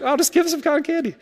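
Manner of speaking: high hurried sympathetic plea; high, fast pleading voice. Retold or imitated. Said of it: "It's like".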